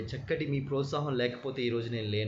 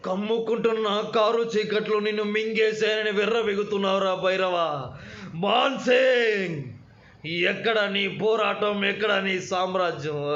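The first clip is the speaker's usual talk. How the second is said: imitating Srihari, higher and louder; 7 semitones higher, 5 dB louder than usual. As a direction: deliver it like a menacing film villain. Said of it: "నా శ్రీహరి గారి voice".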